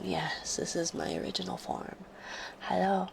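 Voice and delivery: ordinary talk, nothing marked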